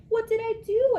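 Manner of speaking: high, loud hamster squeak; worried squeak. A narrator's reading. Hamster squeaking in alarm at the teacher. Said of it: "I squeaked".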